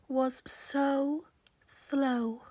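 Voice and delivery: very slow, stretched words; drawn out. A narrator's reading. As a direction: drag the words out slowly.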